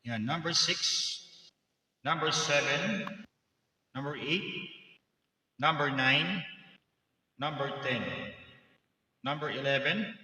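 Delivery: mic-check count with echo; echo and reverb. Shown as a demonstration. Host testing the sound card's echo presets, reverberant mic-check voice. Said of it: "preset ng ating echo sa kanang ating reverb".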